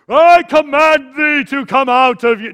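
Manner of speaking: booming, slow dramatic exorcism shout; big theatrical command. Shown as a demonstration. Preacher mocking a showy exorcism, big theatrical shout. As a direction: thunder it out like a showman. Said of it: "we would like a big production".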